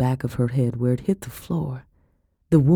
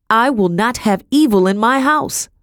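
high, loud indignation; raised-voice fury; outraged refusal; 7 semitones higher, 5 dB louder than usual. A narrator's reading. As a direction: declare it fiercely to a husband. Madam rages at her husband.

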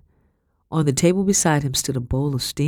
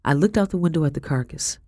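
plain reading voice

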